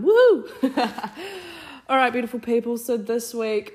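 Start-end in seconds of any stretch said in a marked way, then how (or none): 0.0-0.5 high loud whoop; excited whoop. Real, not acted. Cheering whoop. 0.5-1.8 bright laugh after whoop. Real, not acted.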